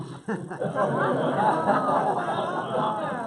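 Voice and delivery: high laughing outburst; helpless high-pitched laugh. Real, not acted. Storyteller recalling the driver without toll cash, laughing.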